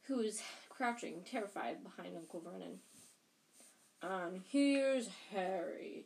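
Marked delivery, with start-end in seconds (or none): 4.0-6.0 slow, louder giant greeting, drawn out; friendly giant. A narrator's reading. Giant spots Harry by the sofa, slow friendly giant voice. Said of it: "said the giant".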